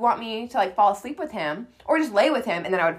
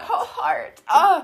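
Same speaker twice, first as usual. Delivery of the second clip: laughing; 8 semitones higher, 4 dB louder, much slower than usual. Real, not acted. Friend melts at cute line.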